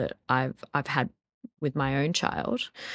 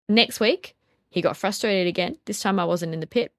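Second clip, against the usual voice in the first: ordinary talk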